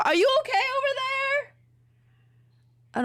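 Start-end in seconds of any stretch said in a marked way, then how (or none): 0.0-1.5 high call to the next room. Real, not acted. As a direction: call out across the house. Calling out to another room.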